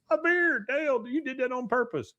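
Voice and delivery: very high, loud mock wail; hammed-up high-pitched whine of complaint; wailing Bill.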